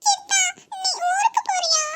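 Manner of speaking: put-on high, childlike and eager; a small niece calling her uncle; little girl voice. Niece asks her uncle if he is leaving.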